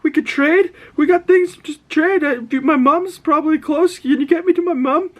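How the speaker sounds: very high, loud imitation of frantic pleading; panicked begging. Dying young man begging his attackers to spare him, panicked begging. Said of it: "He's, like, freaking out, he's dying".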